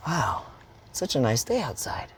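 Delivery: calm after the scream; relieved calm. Shown as a demonstration. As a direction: sigh it out, released and content.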